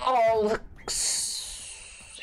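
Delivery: slow, whispered whimper; mock whimper. Real, not acted. Gamer whimpering at a just-missed item. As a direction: whimper at the miss.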